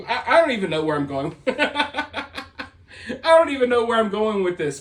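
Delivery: breaking into real laughter mid-sentence; laughing at herself. Real, not acted. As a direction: lose your thread and crack up. Loses her thread and cracks up.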